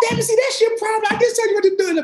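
high, hammed-up coach telling him off; a coach's impatient put-down, played for laughs; mock scolding coach.

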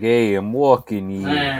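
slow New York tough guy; New York accent. Retold or imitated. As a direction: growl it like a New York cabbie. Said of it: "It's like".

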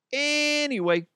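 high, drawn-out comic segue; playful squeaky transition; high-pitched singsong. Pretend. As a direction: stretch it out high and silly. Echoes the squeaky character's catchword.

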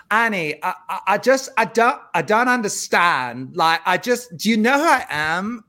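put-on high odd accent, halting; Anna Delvey impression. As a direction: mimic her strange Euro-American accent. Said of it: "I'll do my impression of her first".

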